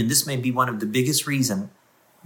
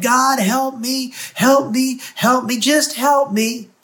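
high, loud and slow begging; desperate plea; 12 semitones higher, 5 dB louder, slower than usual. Acted. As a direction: cry out to God, pleading. Acted desperate prayer plea.

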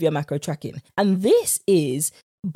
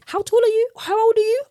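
eager questions; 14 semitones higher, 3 dB louder than usual. Retold or imitated. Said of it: "So you see her and you're like".

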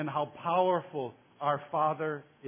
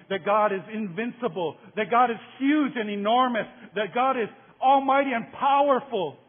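preaching crescendo; 3 semitones higher, 6 dB louder than usual. Real, not acted. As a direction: rise like a preacher proclaiming God's might. Preaching crescendo on God's greatness.

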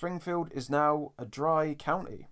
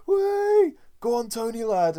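loud, high mock cheer at a mate; cheering shout; 10 semitones higher, 8 dB louder, slower than usual. Mates cheering on a drunk friend, loud cheer. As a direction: yell it like egging on a drunk pal.

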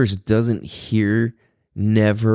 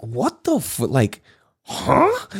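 high, incredulous 'huh?'; retold disbelief; 3 semitones higher, much slower than usual. Reacting to girlfriend's threat to call cops, acted disbelief. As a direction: replay a baffled double take.

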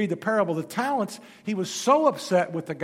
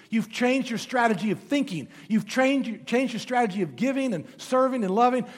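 ordinary delivery

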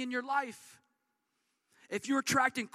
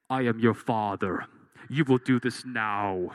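much lower, louder, slow commanding villain voice; Darth Vader voice; 13 semitones lower, 8 dB louder than usual. Retold or imitated. Father to daughter about dishes, mock Darth Vader command. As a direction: boom it out like the masked villain giving orders. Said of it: "the spirit of Star Wars comes over you".

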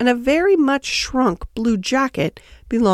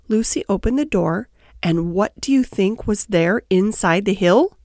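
ordinary delivery, nothing marked

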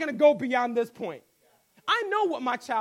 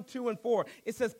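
usual speaking voice

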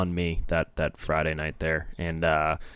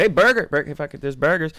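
high, loud calling out burgers; mock eager vendor call; eager burger hawker; 9 semitones higher, 5 dB louder than usual. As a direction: hawk the burgers to everyone like Jake. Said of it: "Jake said".

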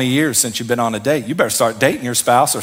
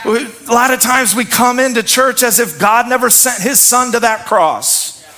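preaching at full force; 7 semitones higher than usual. Acted. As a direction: lift the room with a rousing shout. Preacher's rising exhortation.

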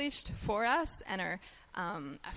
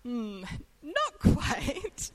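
slow, playful "Hmm, not quite", pitched up; teasing drawn-out reply; 6 semitones higher, 5 dB louder, much slower than usual. Retold or imitated. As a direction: stretch it out teasingly, amused. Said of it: "I was like".

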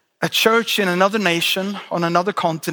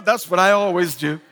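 ordinary talk

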